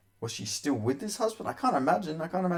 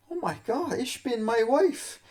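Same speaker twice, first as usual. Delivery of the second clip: high, slow mock German accent in dismay; hammed-up German husband, much higher and drawn out; 11 semitones higher than usual. German husband watching the fight, mock German shock. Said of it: "he thinks".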